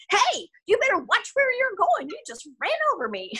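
put-on finger-shaking telling-off in a Mississippi accent. Forceful stage character to a careless shopper with a cart, Mississippi accent. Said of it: "in my best Mississippi accent".

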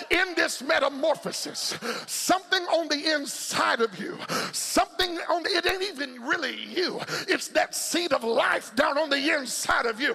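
high, driving gospel shout; full-force preaching. Acted. Preacher rouses congregation to break out of the cocoon, shouted preaching.

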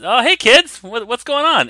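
high-pitched Boston shopkeeper greeting; Bostonian accent. Acted. Shop owner greets the kids walking in, Bostonian accent. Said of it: "in a flawless Bostonian accent".

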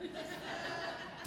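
a quiet, unvoiced chuckle; soft huff of a laugh; breathy laugh. Real, not acted. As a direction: laugh under your breath at your own joke. Laughs at own joke.

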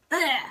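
sharp disgusted groan; a short repelled grunt; high loud ugh of distaste. Acted. Disgust at bad behaviour.

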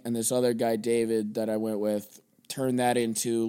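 normal talking voice

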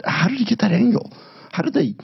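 high, amazed and wondering. Retold or imitated. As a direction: marvel at the clever camera angle. Retelling amazement at a video.

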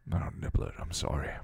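low, hushed, tender apology; softened apology. A narrator's reading. Man reaches for the crying girl, softening into an apology. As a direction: drop to a near-whisper and soothe her. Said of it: "he said, softening".

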